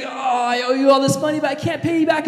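high, pleading cry of a man in debt; desperate debtor. Retold or imitated. Debtor pleading with the king, high and desperate. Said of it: "he's like".

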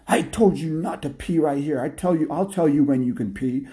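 high-pitched, bossy telling-off; scolding dog master. Acted. Dog pulling on the lady's collar, bossy scolding.

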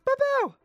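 high popping bang sounds; gunshot noises. Acted. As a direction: shout bow bow like gunfire. Mouth gunshots while under fire.

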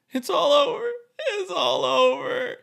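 high, loud, crying wail; sobbing. Acted. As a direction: break down sobbing, slowing. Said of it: "He looks like he's sobbing".

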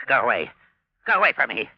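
loud, frightened plea, rising; terrified cry to be left alone; panicked shout. Acted. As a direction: drive the voice off in a panic. Miser panics at the voice.

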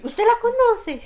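surprised he knows her mother; surprised question. Retold or imitated. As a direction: jump up in pitch, amazed he knows her mother. Surprised she asks the officer.

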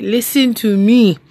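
slow, firm teacher voice; slow down and command attention. Retold or imitated. Teacher setting the student straight, slow and firm. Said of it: "I'm like".

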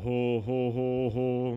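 jolly Santa ho ho; Santa laugh. Pretend. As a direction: play Father Christmas laughing. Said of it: "Du bist nicht der Nikolaus".

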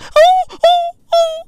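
very high dog whimpers; high, hooting puppy yelps; imitated dog. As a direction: mimic a cowed dog whining. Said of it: "the dog was like".

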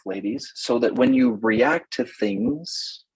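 ordinary delivery

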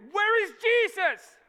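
high, loud panicked shout; drawn-out frantic cry; freaking out. Acted. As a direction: yell out for help in the storm. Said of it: "they're all freaking out".

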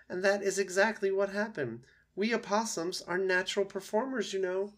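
proud, showy opossum rising high at the end; theatrical opossum. Acted. Opossum explaining its playing-dead trick, theatrical. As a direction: boast like a natural performer.

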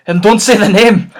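loud fretful gamekeeper; Hagrid voice. Acted. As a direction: beg him loudly, big and upset. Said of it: "in Hagrid voice".

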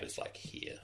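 whisper. Real, not acted. GM points at map softly.

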